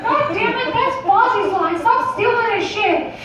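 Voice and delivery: high-pitched indignant squawk; exasperated high cartoon complaint; irritated outburst. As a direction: protest way up high, laughing through it. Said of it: "I was like".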